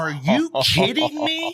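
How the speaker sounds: high-pitched, drawn-out disbelief; incredulous. Retold or imitated. Hunter reliving his deer falling over, incredulous.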